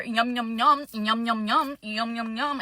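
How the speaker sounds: playful repetitive munching noises; munching imitation. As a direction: mimic someone happily gobbling food. Mimics grandma eating oysters.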